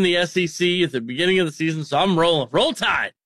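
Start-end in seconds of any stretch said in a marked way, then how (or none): 2.5-3.1 team cheer. Real, not acted.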